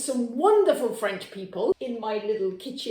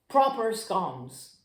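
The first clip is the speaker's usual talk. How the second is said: mock Devonshire accent; 6 semitones lower, slower than usual. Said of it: "as good as my Devonshire accent".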